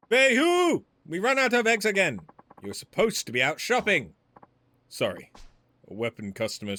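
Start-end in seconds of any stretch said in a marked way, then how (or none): 0.1-4.1 put-on loud, much higher Chinese-accented scolding. Woman scolding Fei Hu about eggs, loud Chinese accent. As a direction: yell at him for forgetting the eggs, thick Chinese accent. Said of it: "written with the offensive accent".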